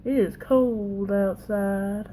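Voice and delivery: put-on slow, drawn-out Southern American accent. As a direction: lay on a lazy Southern twang. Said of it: "Southern accent thing, right?".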